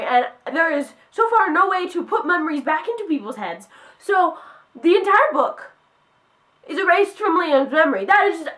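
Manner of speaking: can't believe the author did it; real upset outburst; worked up. Real, not acted. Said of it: "that's all I'm getting, um, worked up about".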